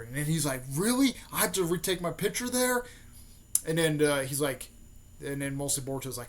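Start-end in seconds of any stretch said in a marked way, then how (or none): 0.7-2.8 high, loud mock outrage; indignant disbelief. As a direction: protest in raised-pitch disbelief. Naruto objects to retaking his picture.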